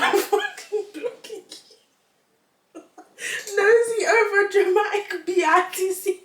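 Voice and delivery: high, loud words cracking with laughter; laughing while reading. Real, not acted. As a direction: barely get the quote out for laughing. Reading neighbor's reply, laughing.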